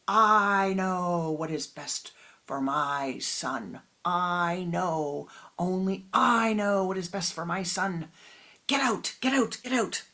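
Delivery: raging shout. A narrator's reading. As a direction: throw him out in blind rage. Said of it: "filled with rage".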